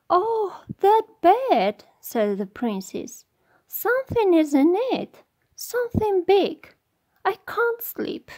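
high, whiny princess voice; cartoon princess. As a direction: complain like a fussy storybook princess. Princess complains about the bed.

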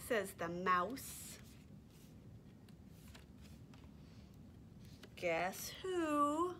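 lively, sing-song storytime reading; animated picture-book read-aloud; reading to toddlers. Acted. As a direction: read it like you're holding the book up for little ones.

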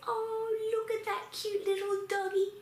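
high, sustained doggy-adoring coo; cooing delight. Pretend. Teacher playing tourist seeing cute dog, cooing.

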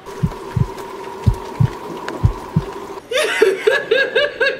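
long, high-pitched laughing fit; helpless real giggles. Real, not acted. Reader cannot stop after the story, laughing.

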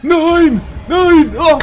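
very high, very loud panicked scream; terrified scream. Real, not acted. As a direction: scream as it grabs you. Caught by monster.

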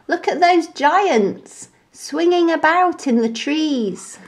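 listen in alarm; worried monkey. A narrator's reading. Frightened monkey.

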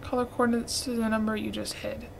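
reading hint quietly. Shown as a demonstration. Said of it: "repeats it back".

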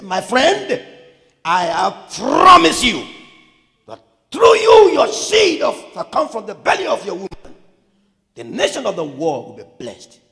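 high, shouted promise; thundering, emphatic pledge; emphatic voice of God. Acted. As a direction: proclaim it loudly with great force. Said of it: "God was emphatic in his, in his words".

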